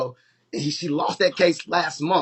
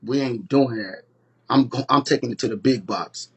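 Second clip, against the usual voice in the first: retold low defiant no; cold refusal; 7 semitones lower than usual. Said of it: "looked at the motherfuckers and said".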